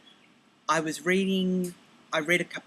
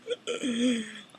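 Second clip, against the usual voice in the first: imitating husband's gasp; 6 semitones higher than usual. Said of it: "And he kind of went".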